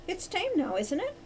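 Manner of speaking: high little cocky voice. A narrator's reading. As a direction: challenge the warning lightly. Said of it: "she said cockily".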